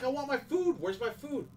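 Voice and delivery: high, pleading "I want my food"; whiny wife impression. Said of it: "she's like".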